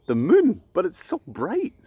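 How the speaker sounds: put-on a child marvelling at the moon; amazed little girl. Ella wonders at the moon.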